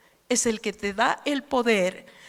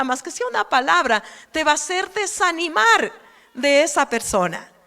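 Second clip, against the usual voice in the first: higher, louder preaching call; 3 semitones higher, 3 dB louder than usual. Acted. Preacher urges the congregation to seek the Holy Spirit, fervent and raised. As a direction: rise up and urge them to seek the Spirit.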